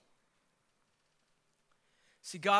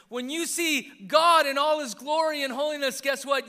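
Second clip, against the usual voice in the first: high, loud impassioned preaching; preaching at full force; 6 semitones higher, 10 dB louder than usual. Real, not acted. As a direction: preach it out loud to the whole room, pitched up.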